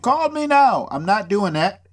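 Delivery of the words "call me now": higher psychic hotline pitch; a hint of a TV psychic ad; Miss Cleo impression. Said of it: "I'm not Miss Cleo".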